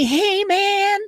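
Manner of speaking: put-on high, friendly American agent accent; mock American customer service accent. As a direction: greet like a cheerful American agent. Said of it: "in an American accent".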